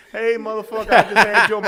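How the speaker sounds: high, loud imitation of an irate wife; mock angry call. Imitating wife's call as he leaves town.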